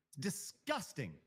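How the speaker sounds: usual speaking voice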